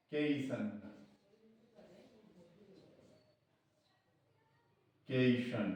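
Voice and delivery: केशन said softly as "KEI-shan"; soft, isolated केशन with a long "ei"; pronunciation demonstration. Shown as a demonstration. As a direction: lengthen the first vowel of केशन, quietly. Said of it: "ये उच्चारण होगा इसका".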